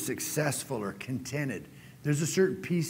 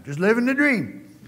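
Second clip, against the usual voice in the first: upbeat catchphrase; 9 semitones higher, 7 dB louder than usual. Shown as a demonstration. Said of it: "you try it sometime".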